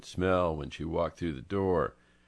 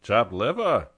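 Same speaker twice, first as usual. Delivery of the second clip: put-on Chopped liver in a louder Brooklyn accent; 4 semitones higher, 8 dB louder than usual. Angelo asks Francie about the dish in a Brooklyn accent. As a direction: ask it like a Brooklyn local. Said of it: "in his best Brooklynese".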